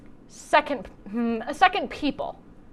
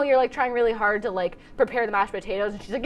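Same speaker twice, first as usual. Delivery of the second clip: ordinary talk, nothing marked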